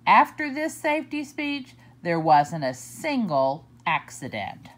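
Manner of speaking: ordinary delivery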